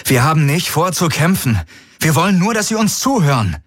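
junge Frau, viel höher gesprochen; hoch und eindringlich bittend; Kirai. A narrator's reading. Kirai spricht zur Flotte. (Kirai, hohe Stimme, beschwichtigend zu den Feinden)

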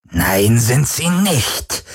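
slow, icy correction; cold interruption. Acted. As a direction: cut him off, deadly calm. Varidur unterbricht kalt. (eiskalt und langsam)

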